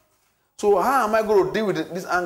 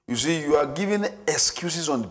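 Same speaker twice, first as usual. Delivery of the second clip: ordinary delivery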